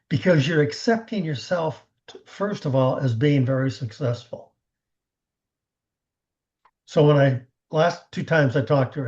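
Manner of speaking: ordinary delivery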